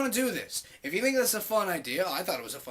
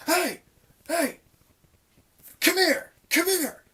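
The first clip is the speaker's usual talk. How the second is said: high, loud acted hail; urgent calling out; 12 semitones higher, 8 dB louder, slower than usual. Clerk hailing a coworker walking by, acted urgent calling. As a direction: call someone over urgently, pitched way up.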